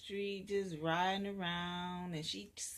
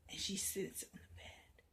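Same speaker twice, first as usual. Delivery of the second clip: hushed narration; whisper; 6 dB quieter than usual. A narrator's reading. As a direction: whisper it softly.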